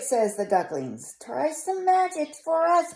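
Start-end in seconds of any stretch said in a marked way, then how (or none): 1.2-2.9 high duckling voices. Acted.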